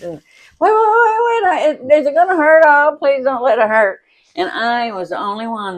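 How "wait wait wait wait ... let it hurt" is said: high, loud frantic begging; crybaby sister pleading not to be hurt; mock pleading panic. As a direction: plead in a hammed-up fright.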